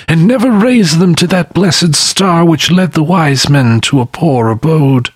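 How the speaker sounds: high lamenting spectre voice; sorrowful restless spirit; mournful ghost. A narrator's reading. The spectre speaks.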